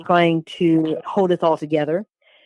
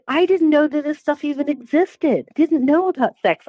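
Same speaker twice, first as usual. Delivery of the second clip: retold tearful shock; 9 semitones higher than usual. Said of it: "I just burst into tears".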